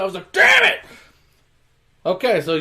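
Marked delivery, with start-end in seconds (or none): 0.3-0.8 high, loud mock-angry yell; pissed-off outburst. Imitating a driver hitting the steering wheel, pissed off. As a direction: act out a furious driver's outburst. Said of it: "He's all pissed off".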